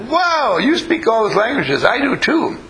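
put-on very high, excited small-child voice; squeaky-high kid impression, delighted; amazed little kid. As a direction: imitate a child bursting with wonder. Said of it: "and the little kid says".

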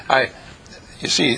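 ordinary talk

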